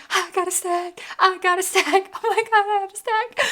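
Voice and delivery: giddy repeating. Retold or imitated. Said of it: "I was literally losing my mind".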